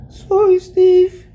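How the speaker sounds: high, wailing sob-talk; crying. Retold or imitated. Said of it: "why do you say it like I'm crying".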